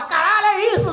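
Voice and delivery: high, loud exclamation of disbelief; imitated shocked outburst; mock astonishment. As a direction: play a guest blurting out in amazement. Imagined guest reacting on the show.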